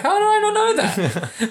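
high, amazed all over again; disbelieving, voice jumping up high; surprised. Real, not acted. Repeats the disbelief.

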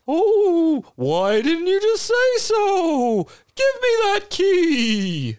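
very high, slow, spooky ghost voice; a drawn-out spooky wail of a ghost. Acted. A ghost introducing himself after slapping the player, high and spooky. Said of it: "All right, Slappy".

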